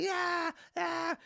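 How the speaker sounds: put-on high dismissive childish yeah yeah; little daughter's voice. Dad quoting his daughter brushing him off, in a high child voice. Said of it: "And she was like".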